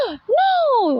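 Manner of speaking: sharp gasp, then a high, loud cry; freaking-out parent. Acted. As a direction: gasp and yell it like a panicked parent. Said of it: "As a parent, you're freaking out".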